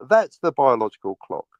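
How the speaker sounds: ordinary talk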